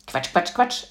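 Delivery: quick run of "Quatsch, Quatsch, Quatsch"; lightly chanted dismissal; playful mocking repetition. A narrator's reading. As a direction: rattle off the repeats playfully. Girl chants while riding a chair.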